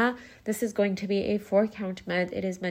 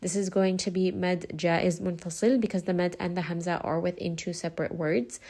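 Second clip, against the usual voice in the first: ordinary delivery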